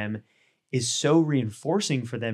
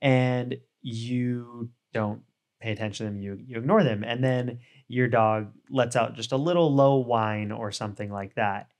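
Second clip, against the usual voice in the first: usual speaking voice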